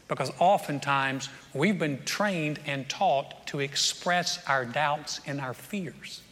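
normal talking voice

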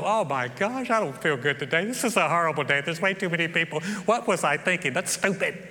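lightly voiced grumbling; mock whiny complaint. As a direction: grumble like a discouraged woman. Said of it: "she could have stepped back and go".

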